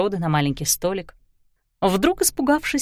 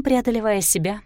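normal talking voice

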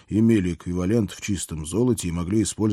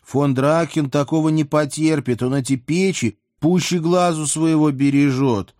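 high, louder character voice; princess's warning; 7 semitones higher, 4 dB louder than usual. A narrator's reading. Princess warns the policeman off the furnaces, high-voiced alarm. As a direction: warn them in a raised, higher princess voice.

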